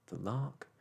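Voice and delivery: plain reading voice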